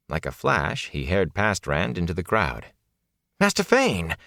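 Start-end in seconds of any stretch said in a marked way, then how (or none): 3.4-4.2 high, urgent call after someone; raised-pitch, exclaimed hail. A narrator's reading. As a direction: call his name as he flees. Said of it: "Rand called".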